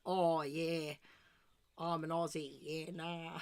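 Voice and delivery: exaggerated Aussie bogan voice; mock-bogan Australian twang; bogan Australian accent. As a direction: ham up a broad Australian accent. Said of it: "when other countries do an Australian accent".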